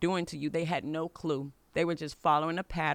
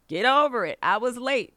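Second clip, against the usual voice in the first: impatient; 7 semitones higher, 6 dB louder than usual. Retold or imitated. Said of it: "expected her to get over it".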